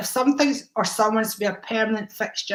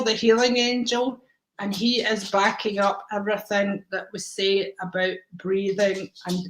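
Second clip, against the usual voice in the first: normal talking voice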